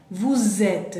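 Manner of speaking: "vous êtes" linked by a buzzing z; liaison with z. Shown as a demonstration. Teacher recalling the liaison, "vous êtes" with a z link. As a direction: join "vous êtes" as "vou-zêtes". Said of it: "Suena como una z".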